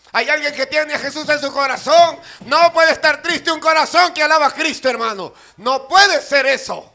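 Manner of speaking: loud rousing challenge to the congregation; full-force preaching. Acted. Preacher asking who has Jesus in their heart, full-force preaching. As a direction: belt it out to rouse the crowd.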